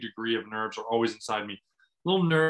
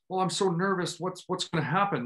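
higher-pitched mock worry; anxious overthinking; 5 semitones higher than usual. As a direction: spiral lightly into pretend nerves. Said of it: "overthinking that and being like".

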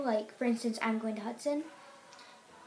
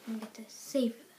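whispering. Real, not acted. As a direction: whisper it like a secret. Whispered aside about memories.

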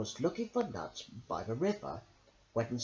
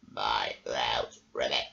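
much lower, croaking grumble; grumbling toad. A narrator's reading. Toad Tipper complains about his flooded house, low croak. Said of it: "Tipper grumbled".

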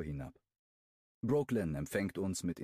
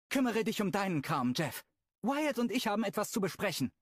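higher, curt female voice; Brooklyn, bossy; 13 semitones higher, 3 dB louder than usual. Acted. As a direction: brush Jeff off firmly. (bestimmend, abweisend)

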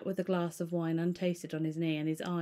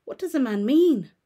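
frightened irritation; 2 semitones higher, 10 dB louder than usual. Acted. Lawyer pressing the butler, frightened and irritated. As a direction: snap it out, scared and cross. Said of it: "a good deal frightened and rather inclined to be irritated".

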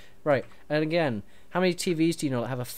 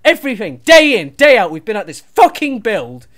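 louder and higher, fed-up tirade; angry rant; 6 semitones higher, 13 dB louder than usual. Acted. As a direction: vent weeks of frustration. Ranting at partner.

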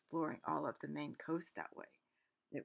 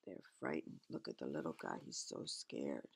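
tender; 14 semitones lower, 6 dB quieter than usual. Real, not acted. Pointing at scared mouse picture.

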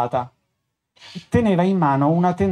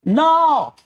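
a high, loud desperate cry; grido disperato; 11 semitones higher, 4 dB louder than usual. A narrator's reading. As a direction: scream it out in terror of the clown. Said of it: "gridò il poverello". (un urlo alto e forte)

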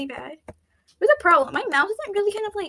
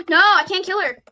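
ordinary delivery

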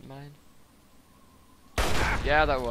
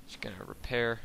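ordinary talk, nothing marked

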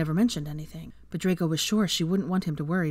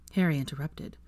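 ordinary delivery

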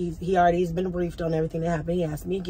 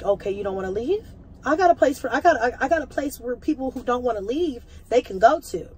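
angry; 6 semitones higher than usual. Retold or imitated. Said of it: "but I was angry".

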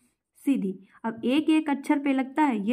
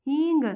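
"हींग" with a loud, pressed first syllable; stress on hee; 5 semitones higher, 3 dB louder than usual. Shown as a demonstration. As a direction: punch the ही in "हींग", then ease off on the end. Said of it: "गा को मैंने आसानी से बोल दिया". ("हींग" में ही पर ज़ोर)

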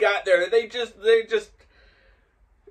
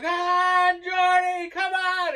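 very high, loud chanting shout; crowd yelling; 8 dB louder, slower than usual. Retold or imitated. Storyteller acts out the crowd cheering him across the road, yelling. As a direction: holler like fans urging him on. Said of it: "they're all yelling".

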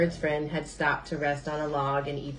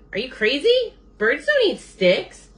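incredulous; 9 semitones higher, 6 dB louder than usual. A narrator's reading. Bird scoffs at Beaver's sticks.

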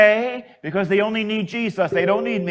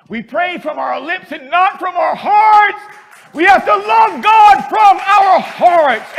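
loud, high exhortation; full-force preaching; 8 semitones higher, 10 dB louder than usual. Real, not acted. Priest urging congregation, loud preaching. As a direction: drive the scripture home.

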